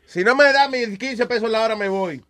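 mock worker's ultimatum. A worker threatening the boss, in a high defiant mock voice. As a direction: play the cocky worker laying down his terms.

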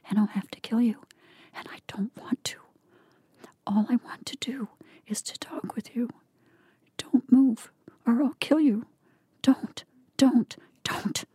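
threatening whisper rising to hissed urgency; menacing whisper. A narrator's reading. Miss Dent threatening Blake with a pistol, whispered. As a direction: whisper the threat, pressing harder at the end. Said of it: "she whispered".

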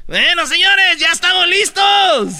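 high, drawn-out call to the crowd; rally the crowd like a warm-up host; stage MC hyping up. Acted. Comic onstage hypes waiting audience, calling out high. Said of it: "te ven subir la gente".

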